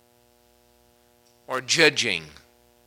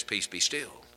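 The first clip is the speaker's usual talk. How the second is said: calm command; 6 semitones lower than usual. Pretend. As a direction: still the storm gently. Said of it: "he turns to the wind and says".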